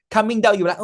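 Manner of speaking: ordinary delivery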